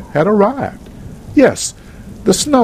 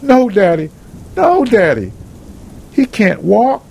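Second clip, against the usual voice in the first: narrator's distraught daughter, pitched up without sobs; higher, acted upset cry to a father; 5 semitones higher than usual. A narrator's reading. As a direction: plead in dismay, voice raised high. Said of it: "crying uncontrollably".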